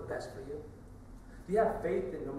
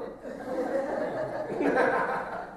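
airy chuckle; breathy laugh; 8 semitones higher, 5 dB louder than usual. Real, not acted. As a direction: laugh under your breath. Laughing at own joke.

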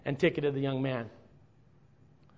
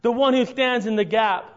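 louder, higher building preacher's cry; preaching climax; 7 semitones higher, 7 dB louder, slower than usual. Real, not acted. Preacher builds to his peak, telling the church of Christ in the gap. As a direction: lift the room to the peak.

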